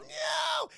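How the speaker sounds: very high wailing no; mock terrified cry. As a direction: wail no as if about to fall. Imitates dangling woman's cry.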